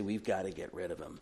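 low and soft, like conspirators; softly voiced scheming leaders; grim plotting. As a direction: murmur it as a quiet verdict. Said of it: "And they say".

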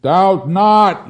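loud, high proclamation; prophetic command. Acted. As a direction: thunder it out like a prophet.

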